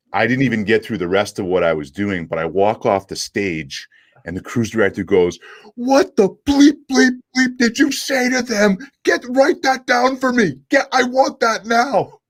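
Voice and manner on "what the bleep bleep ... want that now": very high, loud imitation of a flabbergasted boss; mock astonished outburst. Cruise director to comic coming offstage, hammed-up astonished demand. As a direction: demand it in drawn-out, high-pitched mock amazement.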